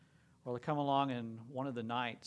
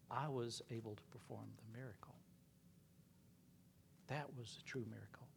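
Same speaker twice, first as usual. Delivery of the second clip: hushed, softer, weeping confession; a broken knight confessing softly; tearful whisper; 9 dB quieter than usual. Retold or imitated. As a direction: whisper it through tears. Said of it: "bawling his eyes out".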